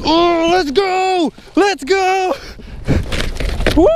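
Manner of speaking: high, thrilled shouts of victory; excited yelling. Real, not acted. Angler yelling to viewers as he lands the fish, thrilled. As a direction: yell it out as the fish comes in.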